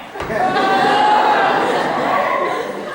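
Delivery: a squealing high-pitched laugh; giddy high laugh. Acted. Benedick alone, overjoyed to be loved, giddy high laugh.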